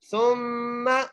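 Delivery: very slow chant, सुम्मा with a humming doubled meem; nasal ghunna. Shown as a demonstration. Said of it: "गुन्ना करके पढ़ेंगे".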